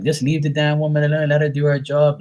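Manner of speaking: mildly exasperated, standing up for a friend; protective complaint. Retold or imitated. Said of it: "her protector".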